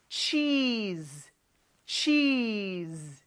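"cheese" slowly, EE stretched out; long EE vowel. Shown as a demonstration. Teacher leads cheese pronunciation practice, long EE. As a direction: drag out the EE in "cheese". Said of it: "좀 길게 이를 끌어줘야".

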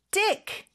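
"Dick", the T of stick softened to a D. Shown as a demonstration. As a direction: say the end of stick as "Dick", with a D sound. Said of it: "D 발음으로 해주시면 조금 더 편합니다".

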